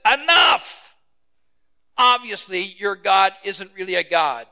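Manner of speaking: furious shout. Acted. Elijah ending the contest with the prophets, shouting. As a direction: explode at them, then drive it home.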